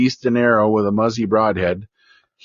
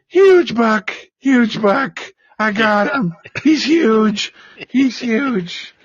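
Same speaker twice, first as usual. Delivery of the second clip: much higher, breathless imitation of an overjoyed boy; ecstatic kid; 11 semitones higher, much slower than usual. Son running up to his dad about his buck, ecstatic high voice. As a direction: gush it out like a boy too thrilled to speak. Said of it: "he can't even talk. He's so ecstatic".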